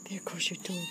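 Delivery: hushed whisper about the toy; whispered playful pet talk. Real, not acted. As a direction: whisper it to the cat as you play. Playing with cat's toy.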